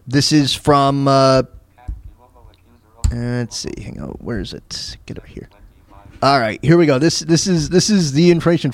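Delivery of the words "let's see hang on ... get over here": hushed, half to himself; whispered aside. Real, not acted. Host searching for the audio clip, whispered aside. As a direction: whisper to yourself while searching. Muttering while hunting for clip.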